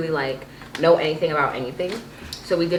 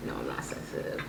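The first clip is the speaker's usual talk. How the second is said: much lower, much softer whispered denial; mock deep whisper; 16 dB quieter than usual. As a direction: mumble it low, playing the tough guy. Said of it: "he was like".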